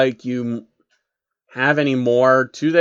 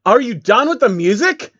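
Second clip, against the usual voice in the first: higher, louder exasperated yell; fed-up guard yelling at the prisoner; shouting; 8 semitones higher, 4 dB louder than usual. Acted. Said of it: "Shining Armor shouted at him".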